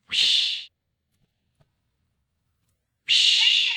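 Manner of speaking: breathy whoosh sound; whooshing magic sound. Acted. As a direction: blow a magic swoosh.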